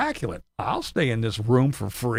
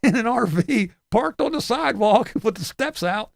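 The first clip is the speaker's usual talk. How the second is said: high, whiny tattletale voice; nosy neighbor reporting the trailer to the HOA, high whiny voice; mock tattling neighbor; 10 semitones higher than usual. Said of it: "and be going".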